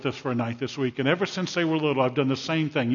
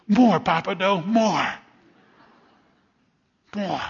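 put-on higher, louder little child pleading; a light imitation of a grandson asking for more; begging toddler; 6 semitones higher, 5 dB louder, slower than usual. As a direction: plead like an eager three-year-old.

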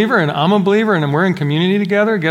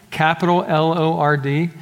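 ordinary talk

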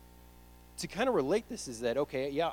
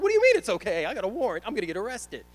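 high, loud mock panic; hammed-up freak-out, voice shooting up; 8 semitones higher, 10 dB louder, faster than usual. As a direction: shriek back in comic disbelief. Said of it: "I'm sitting here freaking out".

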